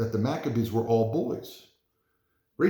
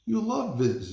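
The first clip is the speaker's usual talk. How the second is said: mom; 11 semitones higher, slower than usual. Acted. Mother voiced in story.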